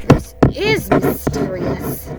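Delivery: Pooh, mystified. Acted. Pooh agreeing about Owl, slow and mystified. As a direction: draw it out in a stagy half-whisper.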